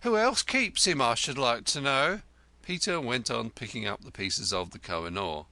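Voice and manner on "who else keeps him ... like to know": 1 indignant. A narrator's reading. Wife continues retort.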